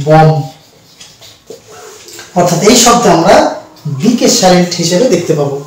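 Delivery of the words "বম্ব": বম্ব said as bom, final b silent; correct pronunciation. Shown as a demonstration. Teacher giving students the correct বম্ব. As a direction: say বম্ব with no b at the end. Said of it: "এর actual pronunciation হবে".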